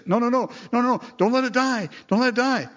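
high, fast frantic pleading; desperate denial. Retold or imitated. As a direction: beg them not to let it die, shrill with panic. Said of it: "a tendency within us to say".

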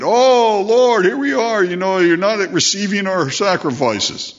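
hammed-up tearful complaint to the Lord; mock tearful plea. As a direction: imitate a hypocrite weeping at the altar. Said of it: "they were crying and weeping".

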